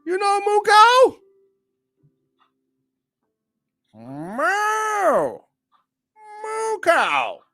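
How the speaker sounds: high, silly mooing voice; playful cow impression; mock cow voice. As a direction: moo it out like a cartoon cow.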